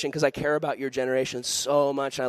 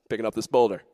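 low, chuckling mock boast; hammed-up easy swagger; playful show-off; 5 semitones lower than usual. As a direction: show off with little effort grunts and chuckles. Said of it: "he lifts up that boulder and he goes".

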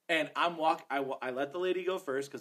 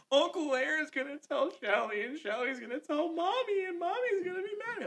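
very high mock wailing child; imitated tearful worry; 12 semitones higher than usual. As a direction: wail it like a worried child in tears. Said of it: "she's in tears".

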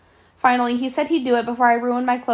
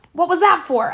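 startled question; 6 semitones higher, 5 dB louder than usual. Acted. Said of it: "I asked, rubbing my arm".

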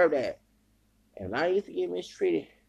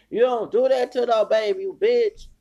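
loud, higher, furious scolding; angry outburst; 5 semitones higher, 6 dB louder than usual. Real, not acted. Scolding a woman who mocked a baby, furious. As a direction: burst out in real outrage.

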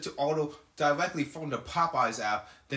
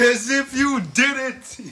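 high, slow, loud mock menace; scary man imitation; 9 semitones higher, 6 dB louder, much slower than usual. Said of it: "This is a lot of scary shit".

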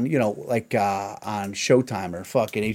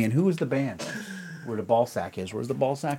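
ordinary talk, nothing marked

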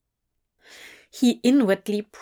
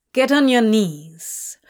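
firm, louder order; commanding; 4 dB louder than usual. A narrator's reading. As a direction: command him down. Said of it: "Crowley ordered him".

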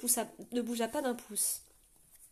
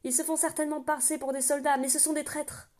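loud, high barked call; roaring; 5 semitones higher, 5 dB louder than usual. A narrator's reading. Kenan shouting up at the guard on the wall, roaring. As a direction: roar it up at the rampart. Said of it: "rugit Kenan".

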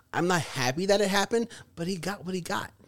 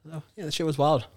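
usual speaking voice

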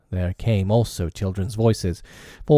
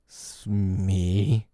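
drawn-out husky address. A narrator's reading. Hook turns to his bosun, huskily. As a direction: say the name huskily, with a quiver. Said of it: "he said huskily".

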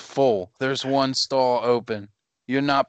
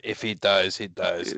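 Dolph Lundgren impression; 7 semitones lower than usual. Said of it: "Special appearance from Dolph Lundgren".

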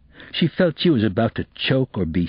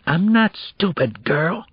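angry whisper; 10 semitones higher, slower than usual. Acted. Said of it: "he whispered angrily".

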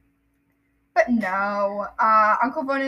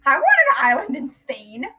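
louder, insistent playful whine; mock demanding; 3 semitones higher, 6 dB louder than usual. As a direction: demand it like an eager child.

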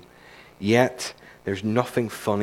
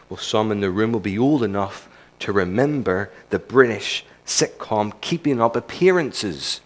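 usual speaking voice

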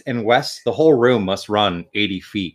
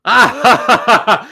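normal talking voice

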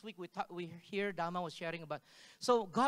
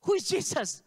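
raised, higher and louder; loud confused question; 8 semitones higher, 9 dB louder than usual. Retold or imitated. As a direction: call out the question in bewilderment. Asking the dream figure.

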